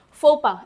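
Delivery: फोपा said as "fo-pa", x and s silent; French pronunciation. Shown as a demonstration. Teacher shows students how to say फोपा, fo-pa. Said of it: "इसको बोलते हैं फोपा".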